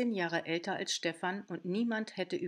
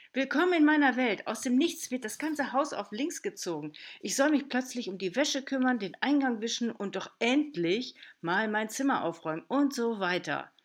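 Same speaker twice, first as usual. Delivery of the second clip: put-on loud, higher, fed-up teenage rant; ranting in a rage; 5 semitones higher, 5 dB louder than usual. A narrator's reading. Teen girl venting to her aunt, furious rant. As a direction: vent it all out, getting louder. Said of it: "Marie hatte sich in Rage geredet".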